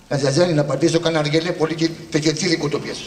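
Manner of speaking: ordinary talk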